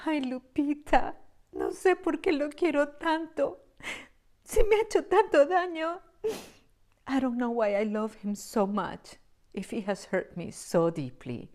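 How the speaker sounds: high, anguished soap-opera lament; telenovela heroine. Retold or imitated. Telenovela heroine lamenting her cruel lover, high and anguished. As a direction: wail it like a suffering telenovela heroine. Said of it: "the main character says things like".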